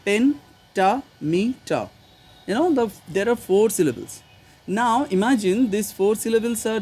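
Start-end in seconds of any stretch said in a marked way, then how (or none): 0.0-1.9 "Pentameter" very slowly, split into pen-ta-me-ter; syllable by syllable. Shown as a demonstration. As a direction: count out the four syllables of "Pentameter", a pause after each. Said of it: "how many syllables you got here".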